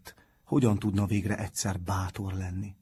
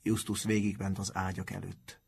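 ordinary delivery

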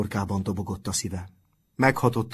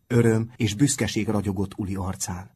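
usual speaking voice

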